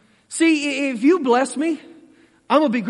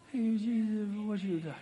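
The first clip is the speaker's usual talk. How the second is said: unexcited mumble; 6 semitones lower, 15 dB quieter than usual. Retold or imitated. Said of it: "more excited than just going".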